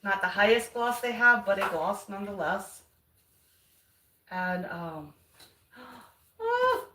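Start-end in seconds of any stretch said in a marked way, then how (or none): 5.8-6.9 high gasp of delight; gasping surprise. Real, not acted. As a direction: gasp in wonder. Gasp at the paint.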